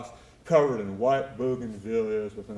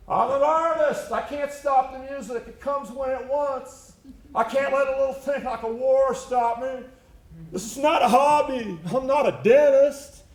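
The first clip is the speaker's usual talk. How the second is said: high, louder indignant shout; shouting back up; 10 semitones higher, 4 dB louder than usual. Retold or imitated. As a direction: yell back up, proud and offended. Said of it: "he would shout back up".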